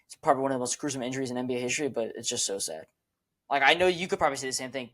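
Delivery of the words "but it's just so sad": disappointed. Real, not acted. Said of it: "it disappoints me".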